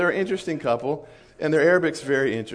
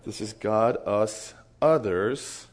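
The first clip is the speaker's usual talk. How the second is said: usual speaking voice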